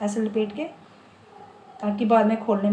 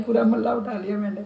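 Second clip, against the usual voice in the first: normal talking voice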